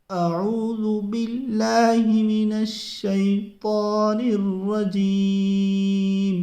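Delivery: slow recitation with heavy full-mouth ط in الشیطان. Shown as a demonstration. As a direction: draw it out, filling the mouth on ط. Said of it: "پڑھ لیجئے آپ ہمارے ساتھ میں".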